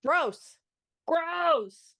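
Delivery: loud, disgusted cry of gross; disgusted outburst. Real, not acted. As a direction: recoil at it out loud. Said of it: "literally putting the flesh".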